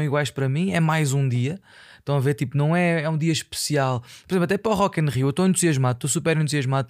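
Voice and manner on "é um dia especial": ordinary talk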